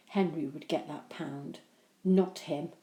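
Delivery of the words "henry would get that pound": usual speaking voice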